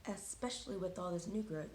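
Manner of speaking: normal talking voice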